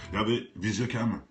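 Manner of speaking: ordinary delivery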